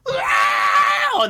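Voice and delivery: loud, piercing imitation scream; high metal scream. Attempting a singer's impossible scream, very high and loud. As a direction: scream as high as you can. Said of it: "no one can scream that high".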